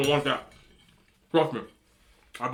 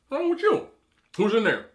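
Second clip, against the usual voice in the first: mock angry ex; 7 semitones higher than usual. Ex shouting through door.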